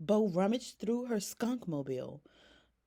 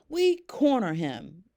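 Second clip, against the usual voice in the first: louder, punchy call; shouted; 7 dB louder than usual. Acted. Bunny shouting the final step of the plan, loud. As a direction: shout it out with gusto. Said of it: "shouted Bunny".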